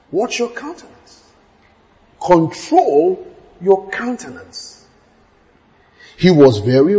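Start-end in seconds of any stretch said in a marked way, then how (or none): 0.1-4.9 soft warning. Shown as a demonstration.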